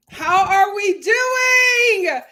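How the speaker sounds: high, loud hype greeting to the chat; excited greeting. Real, not acted. Streamer hyping up viewers as the live opens, loud and high. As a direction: belt out a big hello to the live.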